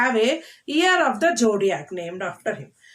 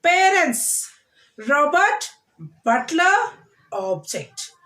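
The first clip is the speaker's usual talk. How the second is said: each word hit on its first syllable, Parents, robot, butler, object; first-syllable stress; 2 semitones higher, much slower than usual. Shown as a demonstration. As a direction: model the stress on the front of each noun. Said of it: "noun, stress the first syllable".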